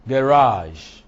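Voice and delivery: "garage" punched loud on RAGE; weak first syllable in "garage", strong second; stress on second syllable. Shown as a demonstration. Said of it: "does not take stress".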